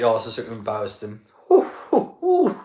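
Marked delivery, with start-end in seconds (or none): 1.5-2.6 very high teasing falsetto oohs; mocking ooh. Real, not acted. Streamer mocks an optimistic fan's boast, falsetto oohs. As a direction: coo at the fan's bold claim like a playground tease.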